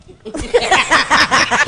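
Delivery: hearty laugh. Real, not acted. Laughing at own story.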